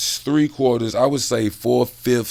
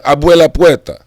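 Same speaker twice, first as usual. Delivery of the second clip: put-on loud, higher Spanish-accented call; 5 semitones higher, 10 dB louder than usual. Telling the Cubans to open up, loud Spanish accent. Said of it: "Different accent, different language".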